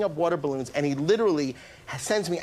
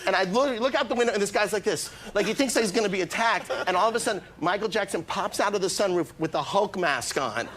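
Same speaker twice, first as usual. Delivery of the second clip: normal talking voice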